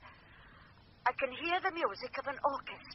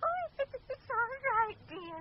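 much higher, laughing through the words; giggling reassurance; 13 semitones higher than usual. Acted. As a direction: giggle as if a spirit tickles you. Medium reacts to a spirit.

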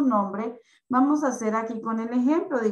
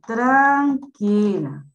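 drawn out very slowly, a little louder; slow dictation; 6 dB louder, much slower than usual. Shown as a demonstration. Teacher writing the word on the board, dictating very slowly. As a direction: stretch it out as you write it on the board. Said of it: "vamos a colocar aquí".

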